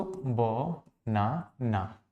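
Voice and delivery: "ब, ना, ना" as three separate syllables with clear pauses. Shown as a demonstration. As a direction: count out "ब, ना, ना" one low, soft syllable at a time. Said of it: "बनाना में कितने syllable हैं". ("ब, ना, ना" हर सिलेबल अलग, रुक-रुक कर, धीमी आवाज़ में)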